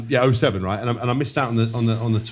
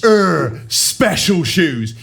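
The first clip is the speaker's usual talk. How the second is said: drawn-out, higher, louder mock drone; mocking teammate drone; 5 semitones higher, 4 dB louder, much slower than usual. Teammate mocking new trainers in a slow, higher drone. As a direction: drag out "special shoes" like a dim taunt. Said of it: "you'd just be like this".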